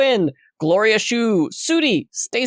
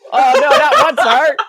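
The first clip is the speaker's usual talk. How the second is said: loud, high, playful protest; hammed-up disappointment; mock dismay; 7 semitones higher, 7 dB louder than usual. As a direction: wail it in pretend horror. Mock reaction to one-star gift.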